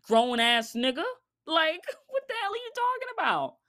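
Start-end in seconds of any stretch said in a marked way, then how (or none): 1.5-3.6 much higher, laughing through the question; laughing disbelief. Real, not acted. Vlogger mocking T.I.'s claim, laughing incredulously.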